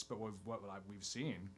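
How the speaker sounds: ordinary delivery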